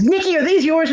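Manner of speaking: high-pitched mock call to a daughter. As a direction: imitate a mother calling her kid from the door. Mother calls to her daughter.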